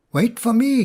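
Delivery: don't leave me behind; cried out. A narrator's reading. Bear calls after the fox, a high cry. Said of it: "cried Bruin".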